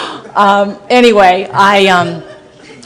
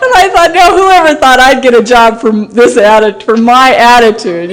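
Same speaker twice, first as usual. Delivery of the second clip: laughing disbelief; 3 semitones higher, 4 dB louder than usual. Real, not acted. Laughing disbelief at job offer.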